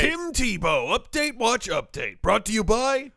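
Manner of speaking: high, loud echo of the title; mock sponsor announcer. Co-host echoing the segment title for a sponsor, mock announcer. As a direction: punch it out like a show jingle.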